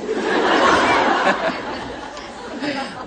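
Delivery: breathy high laugh. Real, not acted. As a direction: laugh out at the memory. Narrator laughs at herself.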